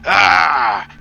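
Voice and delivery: jolted dwarf blurting in confusion; second dwarf caught off guard, loud and startled. Acted. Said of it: "so he's just like".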